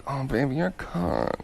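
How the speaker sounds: soft, tender pillow talk; hushed sweet-talk despite the filthy word; loving. Shown as a demonstration. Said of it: "If you say it lovingly".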